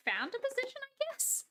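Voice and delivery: playful high-pitched retelling of a message; quoting her own email. Retold or imitated. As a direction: lift the voice up high like an awkward apology. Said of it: "saying, like".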